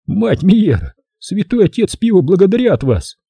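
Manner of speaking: high lisping blessing; lisping whistling voice. Acted. Tommy's voice continued.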